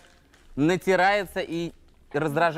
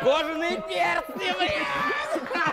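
laughing; 12 semitones higher, 4 dB quieter, much slower than usual. Real, not acted. Friend cracks up at the joke.

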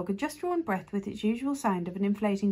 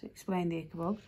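ordinary delivery, nothing marked

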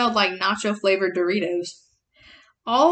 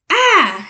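grunt; 7 semitones higher, 8 dB louder than usual. Acted. Khufu grunts.

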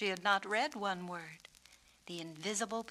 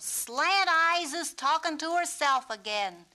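loud, higher mocking yell; yelled taunt; 8 semitones higher, 9 dB louder than usual. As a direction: shout a taunt down the steps. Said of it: "he yelled".

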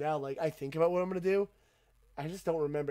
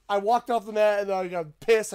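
ordinary talk

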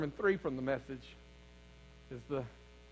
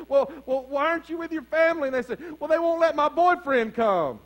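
loud, high, driving preacher voice; full-force preaching; 10 semitones higher, 12 dB louder than usual. Acted. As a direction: preach it hard to the congregation.

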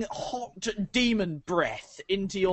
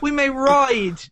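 overly polite coaxing; 2 semitones higher, 5 dB louder than usual. Acted. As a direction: sweet-talk the demon like a butler. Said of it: "You, uh, you say to your demon".

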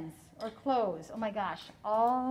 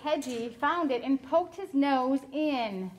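gentle storybook reading; storytelling voice; 3 semitones higher than usual. A narrator's reading. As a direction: read it like a picture book for a class. Reading picture book to children.

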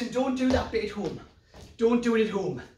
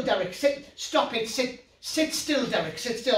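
usual speaking voice

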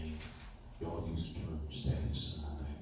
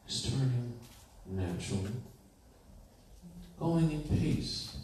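slow, higher, louder hypnotic induction; hypnotist voice; 5 semitones higher, 4 dB louder than usual. Shown as a demonstration. Hypnotist teacher demonstrates putting a class under, hypnotic. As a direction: lull them under like a hypnotist, drawn out. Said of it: "they can literally sit here and say".

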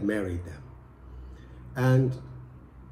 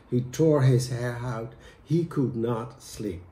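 plain reading voice

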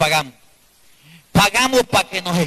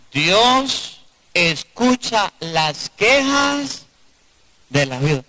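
slow, raised preaching of the verse; much slower than usual. Acted. Preacher proclaims scripture slowly to the congregation.

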